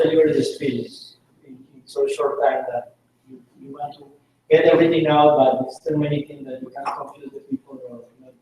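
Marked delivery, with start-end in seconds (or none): none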